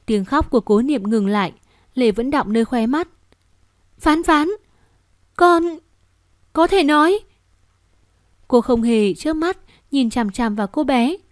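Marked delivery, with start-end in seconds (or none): 4.0-7.2 high, loud, disbelieving cry; stunned joy. Acted. Mother amazed her mute daughter just spoke, high shocked cry. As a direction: gasp it out in amazement.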